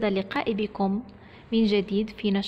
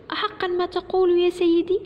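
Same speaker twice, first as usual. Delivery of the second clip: high-pitched amazed question, rising at the end; astonished boy; 8 semitones higher than usual. A narrator's reading. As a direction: ask it in wide-eyed disbelief. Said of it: "سأل كريم في دهشة".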